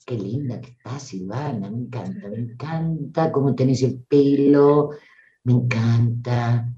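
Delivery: whisper-close tender praise to yourself, slowing on the last me encanta; loving compliments to the mirror; affectionate self-talk. Shown as a demonstration. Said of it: "Usa la cadencia, usa el afecto".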